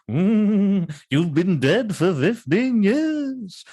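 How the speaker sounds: high, slow, eerie reveal; the blind lover telling the dead man the truth, slow and eerie. Acted. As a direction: draw it out like a ghost story twist. Blind lover reveals his death.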